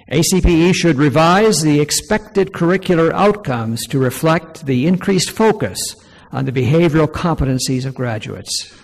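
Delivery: loud, projected reading of a formal statement; formal read-aloud. Real, not acted. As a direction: read it out firmly to a room. Reads numbered recommendation aloud to audience.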